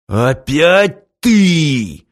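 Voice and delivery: slow, loud angry growl. Acted. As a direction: snarl it out slowly. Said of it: "зарычал санитар".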